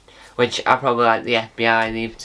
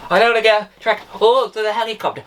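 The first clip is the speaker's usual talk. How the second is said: panicky fugitive; 8 semitones higher, faster than usual. Pretend. Acting a fleeing fugitive.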